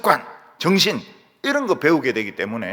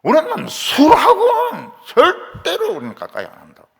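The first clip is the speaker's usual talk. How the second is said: emphatic vow; 11 semitones higher, 6 dB louder than usual. Acted. Voicing the Rechabites' vow.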